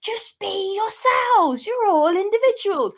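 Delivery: high, slow, hammed-up prophet; mock Brian preaching. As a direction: play Brian urging the crowd, drawn out and high. Brian voiced addressing the crowd.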